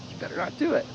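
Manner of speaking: teasing mock threat to the dog; mock warning. Shown as a demonstration. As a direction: tease the dog gently. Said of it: "literally me going".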